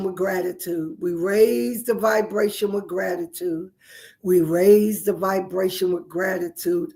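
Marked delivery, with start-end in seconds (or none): none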